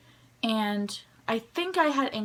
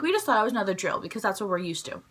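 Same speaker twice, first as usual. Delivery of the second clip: ordinary talk, nothing marked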